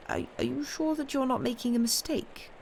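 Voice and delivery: high, faltering, incredulous question; astonished exclamation. A narrator's reading. Notary double-checking the old man's answer, astonished. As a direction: stammer into a high-pitched, disbelieving question. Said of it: "the notary exclaimed".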